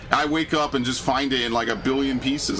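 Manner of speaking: normal talking voice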